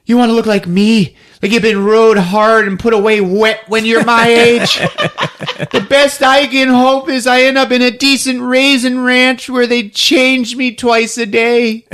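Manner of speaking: high, put-on complaining voice; hammed-up tirade that slows toward the end; ranting mom impression. Mom warning her daughter about her future, hammed-up rant. Mother ranting in a retold scene.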